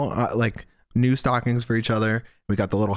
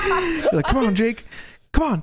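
laughing. Retold or imitated. Mocking her begging Jake.

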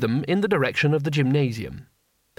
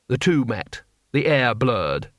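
plain reading voice

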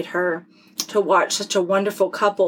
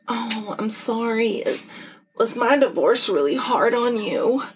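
sarcastic sympathy; 3 semitones higher than usual. Retold or imitated. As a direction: put on fake, drawn-out concern for the friend. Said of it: "I'm being a sarcastic bitch right now".

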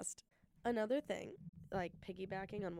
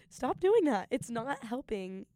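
much higher and louder, a firm order; emphatic command; 4 semitones higher, 10 dB louder than usual. Real, not acted. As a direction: shout the order to stop. Urging viewers to stop self-deprecation.

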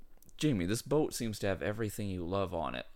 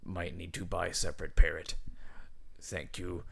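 put-on pirate accent; 4 dB louder than usual. Pirate captain thanking the brothers in his letter, pirate accent. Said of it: "it's a pirate accent".

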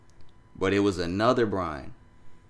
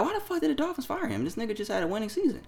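much higher, baffled "why the fuck"; lightly voiced outraged confusion; incredulous disbelief; 14 semitones higher, 4 dB quieter, faster than usual. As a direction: ask it in stunned disbelief, fast at the end. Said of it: "But I'm like".

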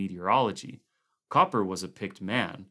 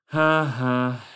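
bitter laugh; 4 semitones higher, 9 dB louder than usual. Acted. Twisted grin, bitter laugh.

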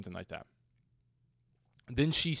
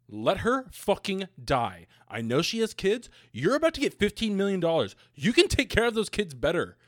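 higher and louder exasperated outburst; worked-up fan yelling about the plot; heated rant; 9 semitones higher, 5 dB louder than usual. Real, not acted. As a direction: vent at the screen like a fed-up viewer. Ranting about hated character.

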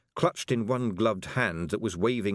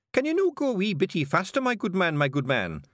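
high Scots, mock-polite; Feegle posing as gentleman; 11 semitones higher, 3 dB louder than usual. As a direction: hurry the carter with fake manners. Feegle urging carter.

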